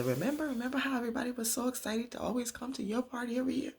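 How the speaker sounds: high, quick put-on daughter voice; daughter's voice. Leah reminiscing to her mother, high daughter voice. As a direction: lift it high and rush through it.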